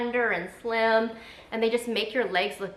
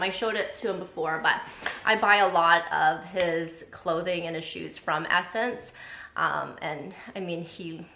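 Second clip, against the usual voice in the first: ordinary talk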